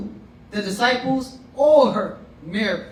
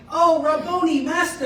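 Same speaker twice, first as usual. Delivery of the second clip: high, delighted cry of recognition; a thrilled gasp of a greeting; joyful recognition; 8 semitones higher than usual. Retold or imitated. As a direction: lift it up like she can't believe it's him. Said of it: "she was like".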